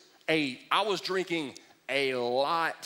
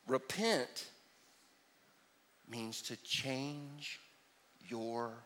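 much softer, slow, down to a whisper on mind; hushed key point; soft emphasis; 7 semitones lower, 12 dB quieter, much slower than usual. Real, not acted. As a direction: lean in and let it fade. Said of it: "quiet emphatic definition".

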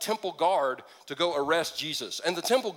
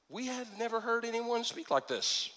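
soft, raised, even-pitched wonder; hushed awe; 4 semitones higher, 7 dB quieter than usual. A narrator's reading. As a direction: say it softly, as if amazed. Guards' awed reply, read softly.

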